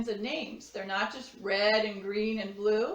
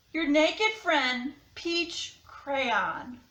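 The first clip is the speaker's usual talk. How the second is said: higher, mock-embarrassed crayon; peach crayon letter voice; 7 semitones higher, 5 dB louder, slower than usual. To Duncan, an embarrassed crayon begs for clothes. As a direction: play up a flustered naked crayon.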